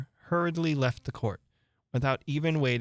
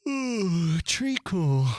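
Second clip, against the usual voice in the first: sleepy voice; 6 semitones higher, 4 dB louder, much slower than usual. Acted. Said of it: "said a sleepy voice".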